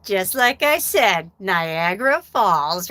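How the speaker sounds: slow, drawn-out knowing quote; movie angel. Retold or imitated. Angel to Bill Murray as the boy cries, slow drawn-out delivery. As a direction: stretch it out like the angel proven right. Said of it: "the angel looks at him and he goes".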